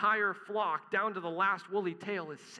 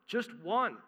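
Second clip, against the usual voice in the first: ONE lifted higher and louder; stress on just one; 5 semitones higher, 5 dB louder than usual. Acted. Preacher to congregation, stressing the one pursued sheep. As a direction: punch the word one.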